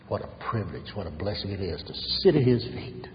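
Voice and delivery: ordinary talk, nothing marked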